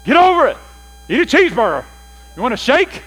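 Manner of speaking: callous husband. Retold or imitated. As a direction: boom out the dismissive husband. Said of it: "we can be callous".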